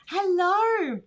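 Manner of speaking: loud, high drawn-out greeting; gleeful pet greeting. Real, not acted. Host greets Elsa once she is up, loud and gleeful.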